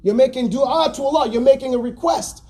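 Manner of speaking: normal talking voice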